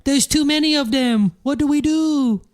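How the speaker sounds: put-on high, thin child's voice; little kid voice. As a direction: play a frightened little kid.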